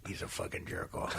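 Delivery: very quiet, low verdict; hushed uncle passing judgment; Uncle Frank. Acted. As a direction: deliver the punchline almost under the breath. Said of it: "This is an impression you've been doing".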